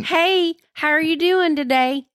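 high, sweet-talking Southern drawl; Southern accent. Retold or imitated. Wife imitating her old calls to mama, high Southern accent. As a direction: lift the pitch into a Southern lilt. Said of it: "when I would talk to my mama".

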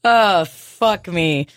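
ordinary talk, nothing marked

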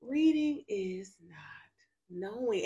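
a hushed whisper of the lesson. Shown as a demonstration. Telling the friend the lesson in a whisper. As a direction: breathe it out, no voice.

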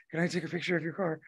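soft, fast timid ask; shy girl. Retold or imitated. Shy girl asking for a car photo, soft and quick. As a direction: mumble it quickly like a shy kid.

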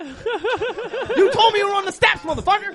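high, loud, slow, laughing protest; mock outrage at the player who lied about the steps; outraged laughing yell. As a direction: wail it half-laughing, betrayed. Protesting at the enemy who tricked him.

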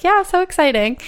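high, sing-song fake enthusiasm; teasing so-excited voice; mock excitement. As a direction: gush it with a wink. Quoting herself after excited husband.

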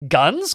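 "Guns" swooping up into a question; "Guns" as an imitated rising question; questioning exclamation. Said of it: "question mark".

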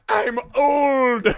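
high, loud put-on whine; hammed-up old-lady wail; mock old Wendy. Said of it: "she's like".

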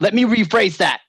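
mock hasty backpedal; quick correction. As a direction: hastily take it back. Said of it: "It's like, quickly".

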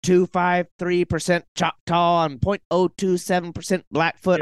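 lightly mock someone boasting tiny fractions of ancestry; a gently sarcastic impression, drawn out and lifted; mocking heritage claimer.

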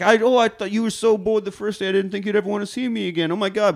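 high-pitched, hurried gushing; gushing date. Retold or imitated. Woman gushing to the man who seemed bored, high and gushing.

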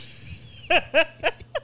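amused laugh. Real, not acted. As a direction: burst of laughter. Laughing at co-host's jab.

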